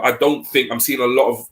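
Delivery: ordinary talk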